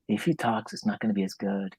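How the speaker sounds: softly voiced audience thought; hushed inner thought. Audience privately judging the play, hushed aside.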